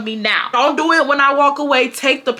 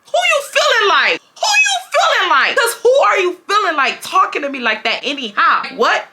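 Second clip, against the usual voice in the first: screaming back; 9 semitones higher than usual. Retold or imitated. Student yelling back at her teacher, defiant shout. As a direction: yell back at the teacher, daring him. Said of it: "I started screaming back at him".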